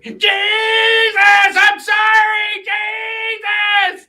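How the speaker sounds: very high, loud, drawn-out wail; hammed-up screamed retort; imitated screaming apology. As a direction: shriek it, stretching every word. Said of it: "I just looked at her and I said".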